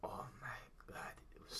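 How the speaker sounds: breathy whispered oh my God; a whispered aside of disbelief. Real, not acted. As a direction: hush it like it still burns. Recalling spicy curry.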